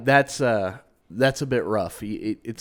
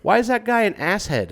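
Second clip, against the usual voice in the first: put-on much higher, childlike questioning voice; a curious little kid from the back seat; Remy asking innocently; 10 semitones higher than usual. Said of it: "And Remy's like".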